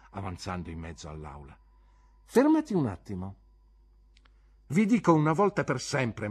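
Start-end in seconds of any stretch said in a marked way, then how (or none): 2.3-6.3 high, loud declaration; heated, insolent warning; defiant ultimatum. Acted. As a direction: lay down the law to the teacher. Pupil gives teacher ultimatum.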